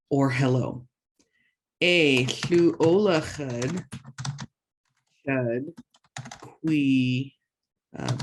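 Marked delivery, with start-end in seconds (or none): 1.8-3.8 "A, llu olachud" spelled out slowly syllable by syllable; slow teaching demo. Shown as a demonstration. Language teacher models the sentence slowly for learners, careful and clear. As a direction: sound it out slowly for the class. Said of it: "this means yes".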